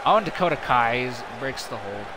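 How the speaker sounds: brisk, running wrestling call; play-by-play commentary. Acted. Commentator calling the match for viewers, brisk play-by-play. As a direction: keep the action rolling like a ringside caller.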